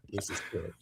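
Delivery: whisper. Real, not acted. Streamer stunned by the clip, whispering to himself. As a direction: drop to a whisper in disbelief.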